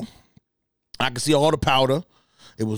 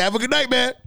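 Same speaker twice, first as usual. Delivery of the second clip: mock passenger goodbye, higher; 10 semitones higher than usual. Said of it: "He said".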